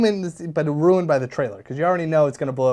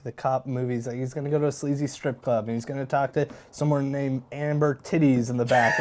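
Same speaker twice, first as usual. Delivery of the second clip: ordinary delivery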